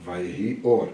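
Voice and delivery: lightly voiced narrator, calm and low. Shown as a demonstration. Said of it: "Volta pro narrador".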